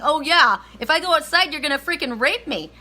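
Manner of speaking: high, loud mock alarm; hammed-up worried protest; imagined alarm. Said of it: "he's probably thinking".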